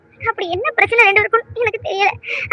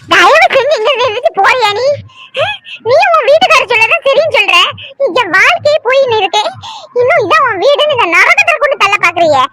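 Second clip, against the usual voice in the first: angry outburst; 2 semitones higher than usual. Acted. Daughter angrily sends mother away.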